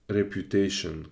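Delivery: ordinary talk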